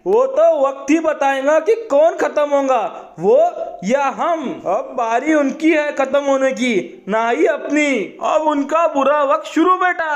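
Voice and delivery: high-pitched battle threat; defiant declaration. Acted. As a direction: declare it high and forcefully, like a challenge. Defiant threat against the enemy.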